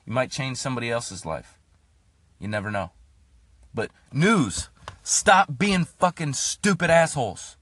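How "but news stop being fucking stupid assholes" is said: slow furious yell; angry yell. Real, not acted. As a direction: spit it out slowly and furious. Yelling at the news.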